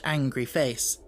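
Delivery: ordinary delivery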